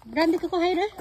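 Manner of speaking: high call to a pet; calling the dog. Real, not acted.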